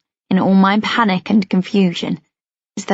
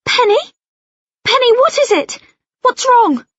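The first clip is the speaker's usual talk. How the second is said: much higher, louder alarmed mother calling; 5 dB louder than usual. Acted. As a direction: call out to the daughter in alarm.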